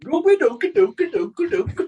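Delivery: gibberish mimicking a sing-song Gothenburg accent, very high; happy Gothenburg accent. Listener mimicking the Gothenburg accent for a Swede, happy high gibberish. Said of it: "it's a happy accent. They go up at the end of it".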